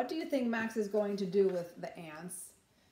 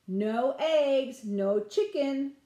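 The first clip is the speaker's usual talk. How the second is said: louder, slow mock-taunting big sister; teasing sister; 3 semitones higher, 6 dB louder, much slower than usual. A narrator's reading. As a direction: drag it out to tease little Max. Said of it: "said Ruby".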